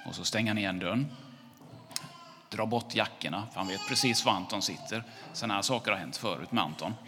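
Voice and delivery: ordinary delivery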